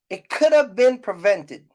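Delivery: ordinary talk, nothing marked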